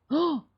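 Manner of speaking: sharp startled gasp; high startled gasp. Acted. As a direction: gasp as if caught off guard.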